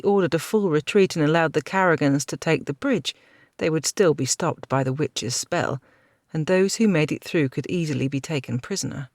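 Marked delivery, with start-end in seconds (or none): none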